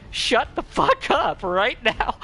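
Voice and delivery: loud, high, drawn-out disbelief; mock outrage. Cosplayer floored by a compliment, mock-outraged yell. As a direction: playfully yell it, slow and high.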